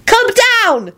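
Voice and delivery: put-on high, bossy child's command. A narrator's reading. Child instructing a flying corpse, bossy. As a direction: order him about in a high voice. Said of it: "instructed him bossily".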